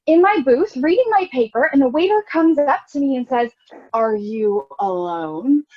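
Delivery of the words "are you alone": slow, drawn-out condescending question; waiter's snide tone. Retold or imitated. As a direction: let the question hang, sneering. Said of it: "It was in his tone".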